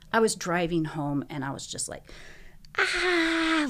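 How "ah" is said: high, sustained scream held on one note; drawn-out "ah" scream, reined in from full volume; retold scream. Driver alone going home after bad news, retold scream. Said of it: "screaming as loud as I could".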